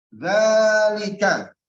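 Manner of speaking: loud, slow stretched long vowel; drawn-out recitation. Shown as a demonstration. Teacher stretching the long vowel loudly for students. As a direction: stretch each syllable slowly and loud. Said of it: "Tadi kan hadza kan".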